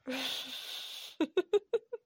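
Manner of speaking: high breathy giggle. Acted. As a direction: break into a quick run of giggles. Laughing at own mispronunciation.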